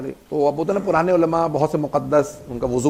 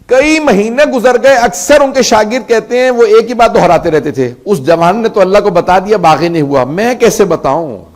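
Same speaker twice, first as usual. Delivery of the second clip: loud, higher impassioned cry, slowing into anguish; preaching at full force; 5 semitones higher, 7 dB louder than usual. Acted. Preacher voicing the weeping scholar to mourners, impassioned cry. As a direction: lament it to the crowd, voice pushed and aching.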